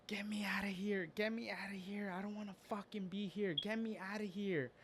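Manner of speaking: high, strained scream held under the breath; hushed mock scream. Patient across the hall screams to get out, hushed mock scream. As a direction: imitate screaming without any volume. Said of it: "he starts screaming".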